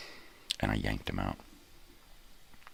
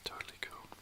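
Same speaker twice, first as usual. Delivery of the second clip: soft whispered aside; whispering; 15 dB quieter, faster than usual. Real, not acted. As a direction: breathe it as a secret.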